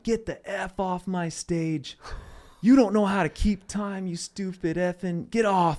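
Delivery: mock the furious yelling, pitched well up; imitated angry yelling. Comic retelling of being screamed off an open-mic stage, imitated yell. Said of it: "he starts screaming at me, yelling".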